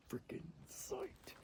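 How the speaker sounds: hushed, slow whisper so birds won't hear; excited whisper. Real, not acted. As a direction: breathe it out, thrilled but quiet. Hearing turkeys, whispering excitedly.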